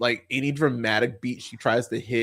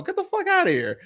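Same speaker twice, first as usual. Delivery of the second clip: high, scoffing dismissal; dismissive scoff. Real, not acted. Film fan scoffing at musical fans, high and dismissive. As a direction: wave the idea off with a scoff.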